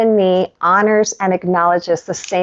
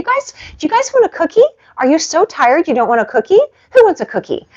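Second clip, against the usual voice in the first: much higher sing-song voice to the dogs; dog talk; 10 semitones higher, 3 dB louder, faster than usual. Real, not acted. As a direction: coax the pups with a treat. Coaxing the dogs with treats.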